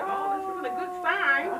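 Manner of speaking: loud, slow, anxious muttered worry; nervous inner thought. Retold or imitated. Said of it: "I'm kinda scared".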